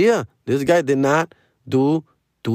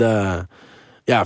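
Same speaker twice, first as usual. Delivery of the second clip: normal talking voice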